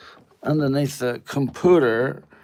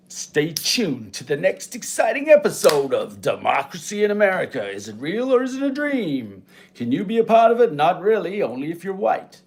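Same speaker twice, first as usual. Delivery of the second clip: mock trailer announcer; 6 semitones higher, 4 dB louder than usual.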